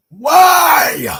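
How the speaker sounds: high, very loud cry of disbelief; exasperated shout. Real, not acted. Horror fan questioning the director's choice, exasperated shout. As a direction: scream the question out in frustration. Climax of rant about strip scene.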